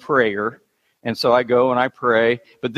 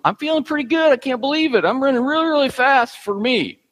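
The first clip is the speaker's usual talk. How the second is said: much higher, thrilled and breathless with pride; a runner's inner voice, much higher and giddy with delight; 11 semitones higher than usual. Retold or imitated. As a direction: crow to yourself, amazed at your own speed. Retells his own thoughts mid-race.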